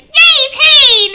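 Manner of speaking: high, loud chirpy yay; cheery character quote. Retold or imitated. Fan imitating Nifty, high loud chirpy cheer. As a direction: squeal it like a manic cartoon girl. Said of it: "where she says".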